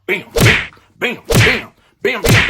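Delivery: loud, explosive "bam" hits; imitated punch sounds. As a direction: punch out each blow as a sound effect. Imitates punches landing left and right.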